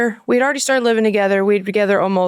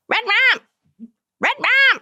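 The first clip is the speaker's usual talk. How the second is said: very high-pitched playful squawk; two short high mock yelps; high silly noise; 13 semitones higher than usual. Wife making the noise her husband hates, high and silly. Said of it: "Dustin hates when I do that".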